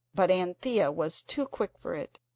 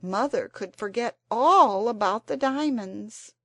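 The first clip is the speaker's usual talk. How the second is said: sudden; 4 semitones higher, 8 dB louder than usual. A narrator's reading. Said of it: "said Jane suddenly".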